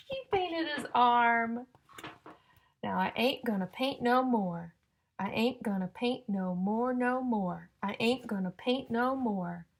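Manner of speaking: loud, slow, sing-song storybook reading; reading to children. Acted. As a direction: build suspense before each rhyme. Reading rhyming picture book to children.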